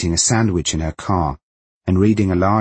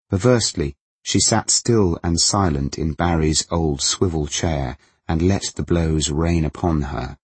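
ordinary delivery, nothing marked

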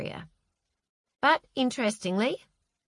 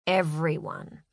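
usual speaking voice

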